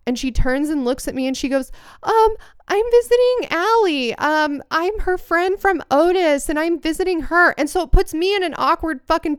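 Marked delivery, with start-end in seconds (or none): 2.0-7.6 high, sweet innocent fib voice; lying girl. Retold or imitated. Girl fibbing to the RA in a high innocent voice. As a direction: play innocent with a higher voice.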